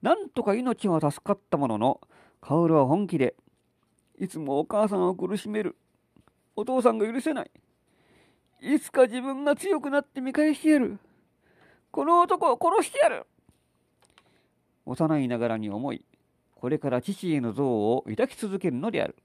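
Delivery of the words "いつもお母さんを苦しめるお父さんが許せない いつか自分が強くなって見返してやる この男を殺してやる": put-on high, vengeful child voice; a small girl vowing revenge; determined little girl. Child's resolve.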